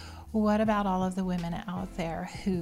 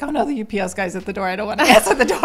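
mock panic; 2 semitones higher, 12 dB louder, much faster than usual. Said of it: "it's like".

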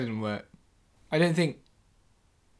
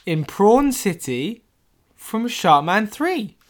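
awed narration; 6 semitones higher, slower than usual. Acted. Awed scene introduction.

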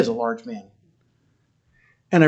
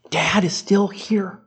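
emotional climax; 5 semitones higher than usual. Real, not acted. Emotional sermon-like closing.

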